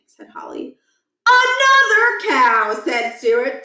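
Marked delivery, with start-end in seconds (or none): 1.3-2.8 high, loud, drawn-out cry; excited shout. Acted. Boy at the window spotting another cow, excited. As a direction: call out with delight.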